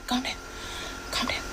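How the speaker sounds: whispered, telling himself to calm down; a breathy self-soothing whisper. Real, not acted. He whispers to himself to calm down.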